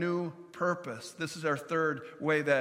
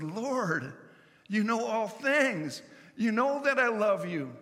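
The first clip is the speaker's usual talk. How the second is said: higher, slower, earnest plea; hurt pleading; 6 semitones higher than usual. Acted. Peter answers Jesus third time.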